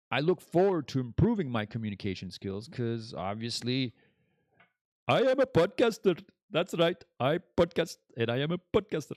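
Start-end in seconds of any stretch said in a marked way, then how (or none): 5.1-9.1 higher and louder, puffed-up announcer voice; hammed-up bragging podcaster; grand self-important declaration; mock pompous boast. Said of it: "No, no, I was just kidding".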